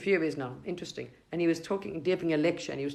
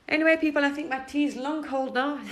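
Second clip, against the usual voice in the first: excited call; 9 semitones higher, 4 dB louder than usual. Real, not acted. Turning back to the audience.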